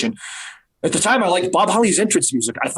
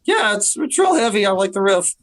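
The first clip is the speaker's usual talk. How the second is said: put-on nervous teen voice; 5 semitones higher than usual. Nervous rookie to Bob Holly, higher flustered teen voice. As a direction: stammer it out like a flustered rookie trying to recover. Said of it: "like, go".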